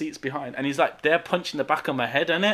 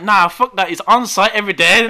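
defiant retort; 4 semitones higher, 10 dB louder than usual. Retold or imitated.

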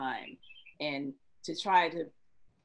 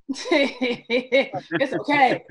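higher and much louder, laughing and teasing; playful laughing tease; 8 semitones higher, 11 dB louder than usual. Real, not acted. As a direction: tease him with a grin, pitched up and loud. Playful threat to make him cry.

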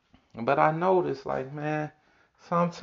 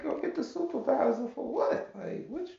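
mock disbelief; 14 semitones higher, 6 dB quieter than usual. His incredulous retort.

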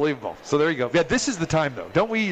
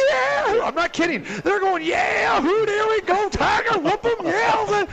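much higher, excited cheers from the fans; imitating cheering fans; slower than usual. Host imitates Auburn fans cheering a big halftime lead, high and excited. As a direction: whoop it up like rowdy fans. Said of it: "these guys are going".

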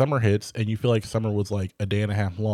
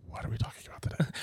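whisper; 3 semitones higher, 9 dB quieter, much faster than usual. Retold or imitated. Retelling her own blank moment.

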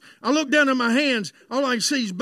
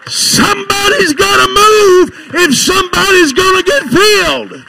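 loud, high Pentecostal shouting, stretched words; full-force preaching; 7 semitones higher, 16 dB louder than usual. Real, not acted. Preacher promising the congregation the Holy Ghost, full-force shout. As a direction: preach it at the top of your voice.

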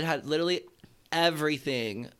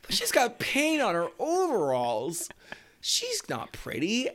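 high, drawn-out mock disdain; hammed-up, high-pitched disbelief; mocking movie trope; 3 semitones higher than usual. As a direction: parody a teen-movie put-down, dragging the words. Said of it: "teen movie trope where it's like".

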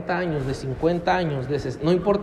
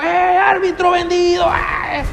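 high, loud jeer at the referee; stadium yell; 10 semitones higher, 5 dB louder, slower than usual. Retold or imitated. Preacher imitating a fan shouting at the referee, loud jeer. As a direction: yell it like a fan insulting the ref. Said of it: "Pero griten, griten en el estadio".

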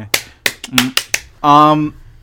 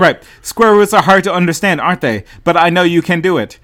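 louder, stilted mock parental encouragement; unloving parents; 4 semitones higher, 6 dB louder than usual. Parent encouraging Melissa on square roots, stilted. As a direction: push out encouragement like someone imitating a human parent. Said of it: "that's pretty much how they talk to her".

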